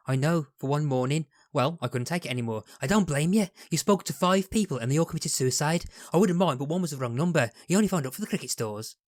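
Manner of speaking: lightly done Scouse Lister voice; a hint of a Scouse accent; attempted Craig Charles impression. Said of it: "your attempted Craig Charles impression".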